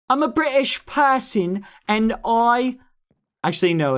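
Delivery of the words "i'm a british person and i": high, slow put-on British accent; exaggerated British impression, pitched up; George. Streamer showing viewers his George, mock British accent. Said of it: "This is George".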